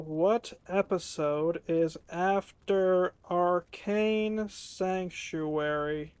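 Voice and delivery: halting pace, as if typing while talking; slow and drawn-out. Real, not acted. Gamer reads out his search as he enters it, slow and drawn-out. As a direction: stretch every word out slowly.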